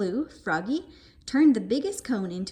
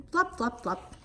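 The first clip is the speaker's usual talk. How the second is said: ordinary delivery, nothing marked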